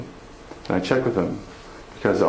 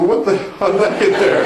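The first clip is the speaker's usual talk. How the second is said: astonished; 10 semitones higher, 4 dB louder than usual. Retold or imitated. Wife finds photo under tile.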